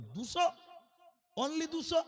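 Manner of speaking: high, rising disbelief; astonished question. Retold or imitated. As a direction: echo the figure in high-pitched surprise. Questioner astonished at small number. (অবাক হয়ে চড়া গলায় প্রশ্ন)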